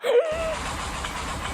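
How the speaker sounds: very high gasp of fright; frightened gasp. Real, not acted. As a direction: gasp sharply in real fear. Thinks enemy will jump on him. (شهقة رعب عالية)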